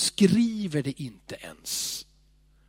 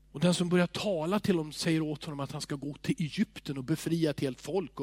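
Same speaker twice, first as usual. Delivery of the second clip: usual speaking voice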